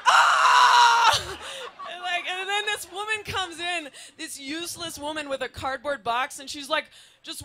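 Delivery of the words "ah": high, loud shout of pain; sudden bitten scream; pained yell. Retold or imitated. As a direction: yelp like a man just bitten.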